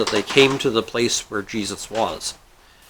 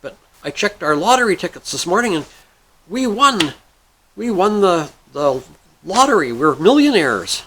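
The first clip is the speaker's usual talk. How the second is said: high-pitched, thrilled big news; excited wife; 6 semitones higher than usual. Acted. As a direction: burst with it, pitch up, stretching the last word. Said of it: "his wife calls and says".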